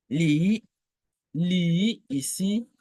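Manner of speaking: Li rising high; second tone. Shown as a demonstration. Teacher modelling the second tone. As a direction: lift Li upward. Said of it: "c'est le deuxième ton".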